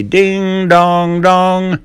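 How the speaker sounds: high sung ding dong bell; bell sound. Acted. Ben playing the riverboat bell, high sung chime. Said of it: "like a bell".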